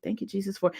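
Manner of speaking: hushed private prayer, very soft; soft prayer under the breath, not a wail; little whisper. Shown as a demonstration. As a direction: murmur a quiet thank-you to Jesus. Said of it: "like the little whisper".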